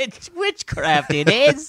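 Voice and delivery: high, slow superstitious peasant voice; old-timey villager. Pretend.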